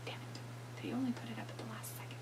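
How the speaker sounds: hushed whisper under the breath. Real, not acted. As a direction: whisper it so the mic barely catches it. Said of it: "Why are you whispering?".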